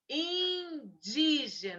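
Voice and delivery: Indígena stretched slowly, syllable by syllable; slow correct dictation. Shown as a demonstration. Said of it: "É in mesmo".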